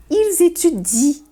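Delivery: Ils étudient said slowly, ending in i, ent silent; slow French. Shown as a demonstration. Teacher shows the silent ent in ils étudient. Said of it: "termina no I".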